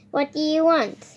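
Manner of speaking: normal talking voice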